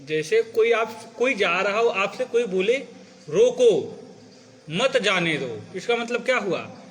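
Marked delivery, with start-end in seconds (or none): 3.3-5.6 firm रोको, stop, then मत जाने दो; रोको alone with a fall, then मत जाने दो together; pause after रोको. Shown as a demonstration. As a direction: break between रोको and मत. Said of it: "इसी शब्द को दूसरी जगह रुक जाओ".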